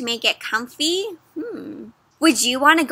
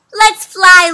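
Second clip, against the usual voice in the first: louder, bright excited call; smiling call; 6 semitones higher, 11 dB louder than usual. Acted. Luna calling everyone to fly, smiling and excited. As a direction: invite everyone up with joy. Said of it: "Luna smiles".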